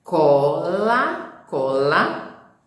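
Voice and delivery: Cola repeated with a crisp K sound; Cola, cola with an exaggerated K; clear word demo. Shown as a demonstration. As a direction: model Cola for the class twice. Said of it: "a gente tem que continuar atento a isso".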